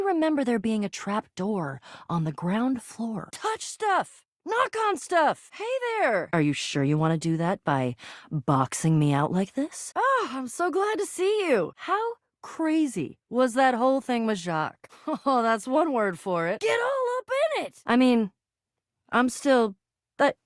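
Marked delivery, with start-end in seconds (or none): 3.3-6.3 high, excited holler; excited calling out. Acted. Game character urging the player on, excited calling out. 10.0-11.7 high, gushing greeting; delighted greeting. Acted. Game character glad to see a teammate, delighted. 16.6-17.8 very high, excited cheer; excited urging. Acted. Game character egging on the player, excited.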